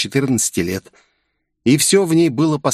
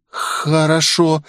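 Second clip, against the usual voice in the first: slow, higher, fearful; a scared girl agreeing; frightened; 6 semitones higher, much slower than usual. A narrator's reading. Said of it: "испуганно ответила девочка".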